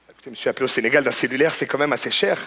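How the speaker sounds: usual speaking voice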